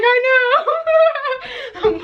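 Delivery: high, laughing mom voice; imitating mom laughing. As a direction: play mom, giggling. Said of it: "Yeah, she's like".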